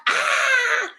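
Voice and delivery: high "Ah!" dissolving into a laugh; playful imitation of everyone's irritated reaction; laughing mock cry. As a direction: cry out half in jest. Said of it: "todos reaccionamos de manera".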